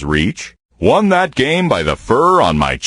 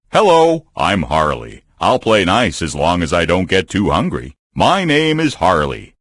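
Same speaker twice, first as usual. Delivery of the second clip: normal talking voice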